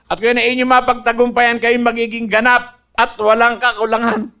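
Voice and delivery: loud, ringing scripture quote; scripture preached. Acted. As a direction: proclaim the verse to the congregation.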